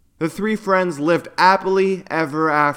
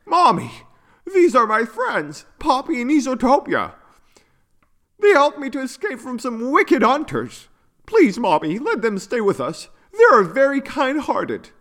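put-on high-pitched child character voice; young unicorn, pleading; 8 semitones higher than usual. A narrator's reading. Yuno asks mother to let friends stay, high pleading child voice. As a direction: plead eagerly like a happy little one.

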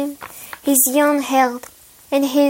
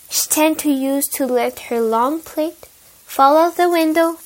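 ordinary delivery, nothing marked

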